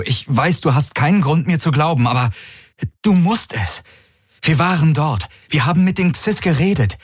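high, pressing warning to the fleet; urgent envoy. A narrator's reading. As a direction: plead urgently over the comm, pitched high. Envoy pleads to be believed. (eindringliche, hohe Warnung an die Flotte)

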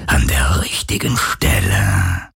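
very low, slow ghost voice; unbekümmertes Phantom. A narrator's reading. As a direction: stretch it out as an untroubled, eerie spirit. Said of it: "sagte das Phantom unbekümmert". (tiefes, gedehntes Geisterraunen)

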